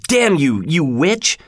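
angry shout. A narrator's reading. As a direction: hurl it as a moderate shout. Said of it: "Hareton shouted".

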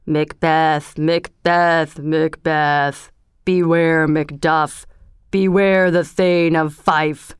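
slow, mournful warning from a floating head; miserable voice. A narrator's reading. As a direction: drag each word out like a doomed spirit. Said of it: "said in a low and miserable voice".

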